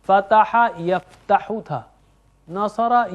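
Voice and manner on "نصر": ordinary talk, nothing marked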